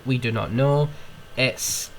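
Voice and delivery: ordinary delivery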